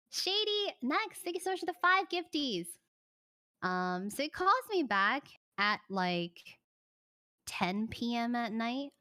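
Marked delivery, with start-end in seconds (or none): 0.1-2.7 high, bright thank-you to a viewer; cheery thank-you. Real, not acted. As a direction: sing out the thanks for the gift, much higher. Reading donation gifts again.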